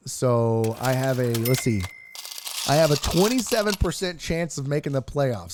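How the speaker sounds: ordinary delivery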